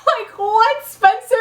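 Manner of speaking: high, laughing incredulity; baffled disbelief. Real, not acted. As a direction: squeak it out, amused and stunned. Amused disbelief at plot twist.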